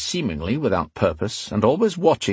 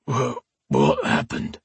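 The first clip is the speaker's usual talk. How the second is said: groggy, slow slur; slow, slurred; 2 semitones higher, slower than usual. A narrator's reading. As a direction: slur it slowly, as if just knocked out. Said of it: "speaking in a slow, slurred voice".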